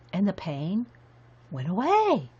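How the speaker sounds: halting retold wonder, pause mid-phrase; imitating amazed relief. Gym teacher after taking a Midol, mock amazement. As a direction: act out a toothache sufferer's sudden relief. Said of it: "he goes like this".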